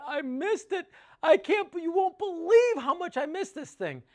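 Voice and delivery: very high, loud gushing; imitating overjoyed customer. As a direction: exclaim it in a hammed-up high voice. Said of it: "unbelievably happy with the system".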